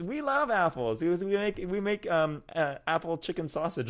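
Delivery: lightly hammed-up farmer; chicken farmer voice. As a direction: play the chicken farmer, keen on apples.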